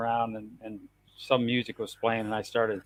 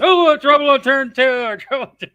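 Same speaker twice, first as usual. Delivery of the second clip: excited shout, pitched way up; race commentator; 12 semitones higher, 11 dB louder than usual. Retold or imitated. Racing fan imitating the commentator who used to wake him, excited shout. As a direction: mimic a NASCAR commentator yelling out the trouble. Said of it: "Daryl or one of the guys was saying".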